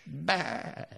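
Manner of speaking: high bleating baa; sheep bleat. Shown as a demonstration. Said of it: "That was Lancashire sheep counting".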